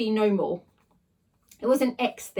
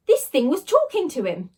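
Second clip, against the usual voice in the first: plain reading voice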